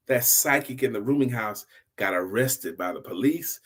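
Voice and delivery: plain reading voice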